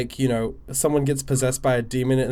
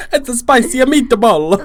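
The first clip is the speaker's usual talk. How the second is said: higher, louder and slow Italian catchphrase; mock Italian; 6 semitones higher, 5 dB louder than usual. Hamming up an Italian catchphrase for laughs. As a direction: savour the spicy meatball line.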